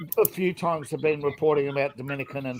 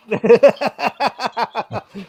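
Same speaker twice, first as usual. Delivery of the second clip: laughing; 5 semitones higher, 4 dB louder than usual. Real, not acted. Laughs at France joke.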